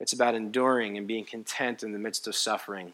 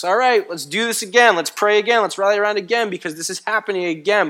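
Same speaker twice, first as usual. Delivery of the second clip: high, louder mock pep-talk, worn out underneath; weary mock rallying call; 7 semitones higher, 6 dB louder than usual. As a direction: pastor mimicking his own tired rallying, mock upbeat. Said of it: "saying to us".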